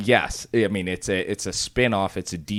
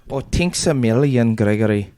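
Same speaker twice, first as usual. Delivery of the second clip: put-on shitty Irish accent; 5 dB louder than usual. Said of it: "shitty Irish accent".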